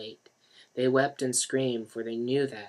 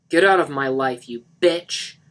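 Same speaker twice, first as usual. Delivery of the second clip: louder angry scream; 3 semitones higher, 11 dB louder than usual. A narrator's reading. Mark screaming Lisa out of his life. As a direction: throw her out. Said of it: "Mark screamed at her".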